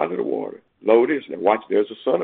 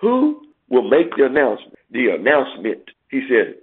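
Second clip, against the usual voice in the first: normal talking voice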